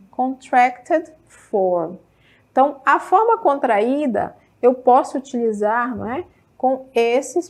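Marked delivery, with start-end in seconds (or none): 0.1-2.0 "Contracted form" said very slowly, every syllable spelled out; slow model pronunciation. Shown as a demonstration. Teacher modelling the term for her students, slow and careful. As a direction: stretch out "Contracted" syllable by syllable for the class. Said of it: "a gente chama de contracted".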